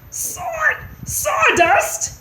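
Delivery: very high, spluttering shriek; shrill choked outcry. A narrator's reading. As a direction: screech it in disbelief. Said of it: "shrieked Ced".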